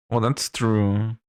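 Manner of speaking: ordinary talk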